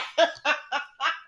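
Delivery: breathy high laugh; delighted giggle. Real, not acted. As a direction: laugh at the drama. Laughing at scene.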